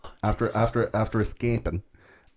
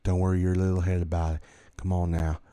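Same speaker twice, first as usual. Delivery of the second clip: lightly imitated southern drawl; murmured imitation of a Southern US drawl; soft southern drawl; 3 semitones lower than usual. Attacker whispering in his victim's ear, soft southern drawl. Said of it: "in a soft southern drawl".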